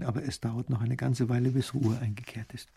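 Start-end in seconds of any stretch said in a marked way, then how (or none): none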